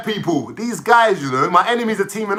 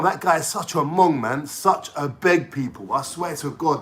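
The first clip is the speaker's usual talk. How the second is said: normal talking voice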